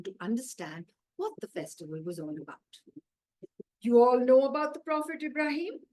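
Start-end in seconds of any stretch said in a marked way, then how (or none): 3.8-5.8 higher, louder voice of the imam asking his pupils; a storytelling imam quizzing his class, louder and higher; imam addressing class. Acted. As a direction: call out to the children like a teacher opening a lesson. Imam begins story for children.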